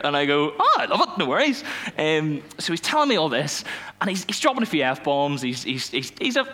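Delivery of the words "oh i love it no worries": cheery reassurance. Retold or imitated. Cheerful reply to driver.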